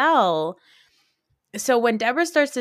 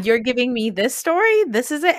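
incredulous, higher-pitched questioning; acted disbelief; 4 semitones higher, 3 dB louder than usual. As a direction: imitate an unimpressed co-star's disbelief. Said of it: "has this look on her face like".

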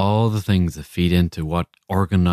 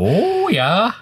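put-on drawn-out, high, rising Fargo accent; exaggerated Fargo Midwestern accent; 11 semitones higher than usual. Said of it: "I'm doing the Fargo accent".

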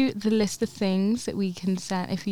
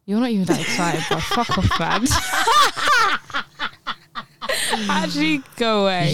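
laughing mock scold; 4 semitones higher than usual. Real, not acted. Mock-scolding unexcited friend.

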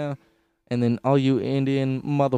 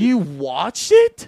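slow, loud, shocked question; 6 semitones higher, 8 dB louder, much slower than usual. Real, not acted. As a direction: stretch it out in disbelief. Shocked disbelief.

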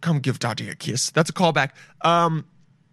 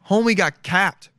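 put-on old-timey gossip; 6 semitones higher, 3 dB louder, slower than usual. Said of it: "they would say it like that".

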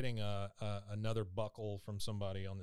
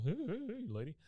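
much higher put-on cartoon kid voice; 11 semitones higher, faster than usual. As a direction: call to the pretty girl, playful. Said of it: "pops his hat up and down like".

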